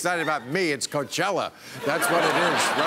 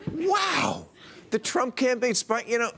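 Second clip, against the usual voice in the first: high-pitched mock-astonished outburst; mock amazement; 9 semitones higher than usual. Comedian reacts to the spying bombshell, mock amazement. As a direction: feign being stunned, pitched way up.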